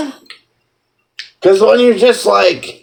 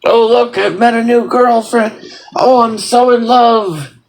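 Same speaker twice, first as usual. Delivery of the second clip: giddy; 5 semitones higher than usual. Retold or imitated. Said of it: "be like".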